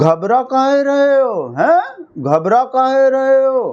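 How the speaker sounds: high, slow, drawn-out teasing; mock taunting. Commentator aping the anchor's taunt at a spokesman, mock taunting. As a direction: needle him with a hammed-up, stretched question.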